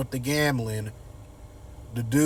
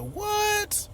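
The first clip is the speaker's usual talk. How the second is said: very high, shocked squeal; shrieking what. Retold or imitated. Haley hearing the cheating news, high shocked squeal. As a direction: shriek it in disbelief. Haley hears it was long ago.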